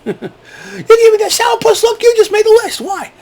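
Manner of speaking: very high cartoon voice; silly list keeper. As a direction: squeak out the threat in falsetto. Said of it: "Bring a little pad and paper".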